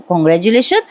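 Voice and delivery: lightly confused, questioning repeat; unsure, questioning "huh?" tone; puzzled echo. Acted. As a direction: echo the word with a puzzled rise. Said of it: "Parth थोडासा गडबडतो".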